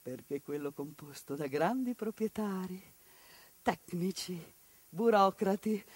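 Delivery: usual speaking voice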